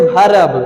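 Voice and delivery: verb in two syllables with R, "bha-rab"; wrong pronunciation. Shown as a demonstration. Teacher warns students off this form, wrong pronunciation. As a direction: sound the R in verb. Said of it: "Don't say verb".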